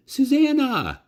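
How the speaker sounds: high, loud glad call. Acted. Nellie calls to the rabbit by her old name, joyful. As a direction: call out with joy.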